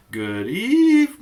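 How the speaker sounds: high, loud stretched yawn of a greeting; really tired. Shown as a demonstration. Teacher shows kids good evening when really tired, mock yawning. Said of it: "let's say it like we're really tired".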